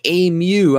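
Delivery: "A Mew" said high as "ay-myoo"; mispronounced name. Retold or imitated. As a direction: imitate the boss's botched "A Mew", pitched high. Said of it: "He was calling me, like".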